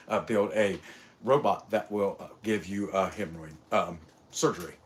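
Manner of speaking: a low, hesitant, mumbling take on Elon; Elon impression. Mocking a tech boss who hems and haws over a hemorrhoid robot, voiced low and soft. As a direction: stammer through it like a halting tech billionaire. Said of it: "that's how he talks".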